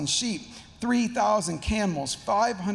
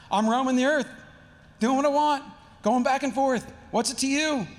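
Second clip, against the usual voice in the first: louder, higher, insolent retort; sarcastic teen; 6 semitones higher, 3 dB louder than usual. Acted. Satan answering God like a teenager, sarcastic. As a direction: throw it back like a defiant teenager. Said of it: "it's just so sarcastic".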